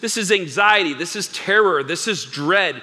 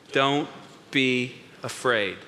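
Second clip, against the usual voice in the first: soft, slow, measured words; calm reassurance; 5 semitones lower, 5 dB quieter, slower than usual. Acted. Preacher leads congregation in Jesus' words, calm reassurance. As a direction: lead them gently, pausing after the first word.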